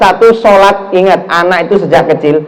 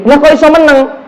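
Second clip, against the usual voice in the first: aunt's surprise; 8 semitones higher than usual. Retold or imitated. Aunt amazed the child is calm.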